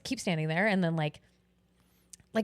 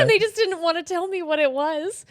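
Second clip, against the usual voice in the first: laughing; 10 semitones higher, 7 dB louder than usual. Real, not acted. Still laughing at the story.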